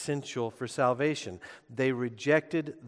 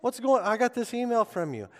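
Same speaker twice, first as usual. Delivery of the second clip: much higher, louder, alarmed concern; worried alarm; 11 semitones higher, 6 dB louder than usual. Retold or imitated. Congregants at the pastor's door, much higher alarmed concern. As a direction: rush in checking on your pastor, alarmed. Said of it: "I had people show up at my front door".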